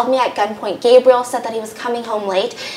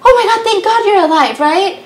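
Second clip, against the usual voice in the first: much higher and louder, gushing relief; relieved; 10 semitones higher, 6 dB louder than usual. Retold or imitated. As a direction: burst out in breathless relief. Husband relieved wife is alive.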